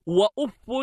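وأف ending in "un", "uffun"; nominative tanwin. Shown as a demonstration. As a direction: say وأف as "wa-uf-fun". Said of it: "بالرفع".

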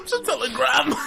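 high cry-shout breaking into a laugh; laughing disbelief. Real, not acted. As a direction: squeal the question then crack up. Incredulous laughing question.

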